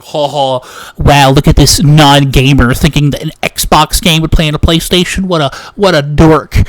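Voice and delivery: loud, sneering gamer taunt; hammed-up smug ridicule; smug mockery. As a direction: jeer at him like a smug know-it-all. Mocking the smug main character.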